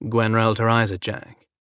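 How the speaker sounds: ordinary delivery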